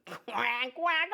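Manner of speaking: high, cheerful cartoon duck voice; a chirpy little duck saying hello to kids; friendly duck puppet. Duck puppet joke and quacks.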